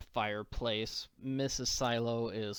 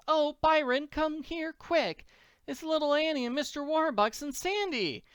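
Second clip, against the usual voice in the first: put-on very high, calling old-lady voice; excited farm wife; 13 semitones higher than usual. Missus Silo calls Byron to see Annie, very high and excited. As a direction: call your husband over in delight.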